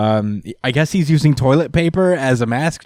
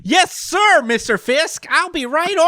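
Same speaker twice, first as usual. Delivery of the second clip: much higher, laughing flunky voice; hammed-up high obedient soldier; eager yes-man voice. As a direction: squeak out blind obedience to the boss. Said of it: "if they put him in that voice".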